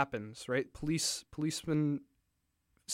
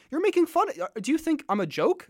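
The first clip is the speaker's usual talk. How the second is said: a sharp jump up in pitch, affronted; a touch of the offended general snapping; indignant general, half-hearted; mock angry general; 11 semitones higher, 4 dB louder than usual. General angrily voiced in story.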